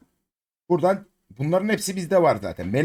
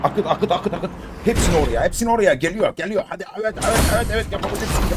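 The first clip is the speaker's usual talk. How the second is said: root for the roll like a fan; excited cheering; 6 semitones higher than usual. Real, not acted. Streamer cheering the item roll on, excited and higher.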